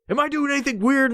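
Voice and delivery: high-pitched anxious squeak; paranoid asking. Retold or imitated. Stoner checking with housemate, paranoid. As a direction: ask it high, comically worried.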